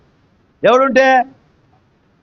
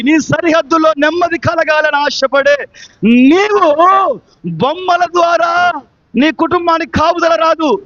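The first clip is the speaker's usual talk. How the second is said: impassioned, raised sermon delivery; full-force preaching; 5 semitones higher than usual. Real, not acted. Preacher warning the congregation of trouble at home, full-force preaching. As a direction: drive each phrase out to the congregation.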